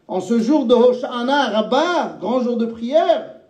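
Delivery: high, rousing preacher's cadence; fervent preaching. Real, not acted. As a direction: preach it out to the congregation. Preacher in full flow.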